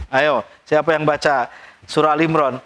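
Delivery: ordinary talk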